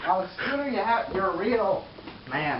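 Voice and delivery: usual speaking voice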